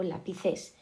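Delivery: lapiCES, leaning on the final syllable; stress on last syllable. Shown as a demonstration. Teacher trying another option, lápices stressed on CES. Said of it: "¿dónde está la sílaba fuerte?".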